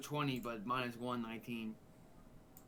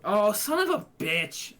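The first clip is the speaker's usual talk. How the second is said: loud, higher, real outburst; frustrated shout; 6 semitones higher, 10 dB louder, slower than usual. Real, not acted. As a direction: curse the dice out loud. Bad dice roll, real outburst.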